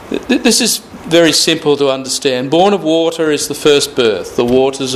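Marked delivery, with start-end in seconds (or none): none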